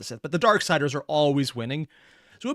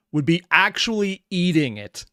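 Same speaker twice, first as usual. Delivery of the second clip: excited; 6 semitones higher, 7 dB louder, slower than usual. Real, not acted. Excited reaction to scene.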